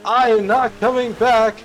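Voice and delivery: high, loud refusal; defiant yell. Retold or imitated. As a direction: shout the ultimatum from the sea. Defiant shout from the water.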